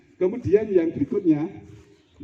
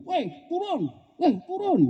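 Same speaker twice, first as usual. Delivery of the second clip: high, pushy yelled command; perintah memaksa; slower than usual. Acted. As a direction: order them about in a very high voice. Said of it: "memaksa orang lain untuk memahami saya". (teriakan menyuruh bernada tinggi)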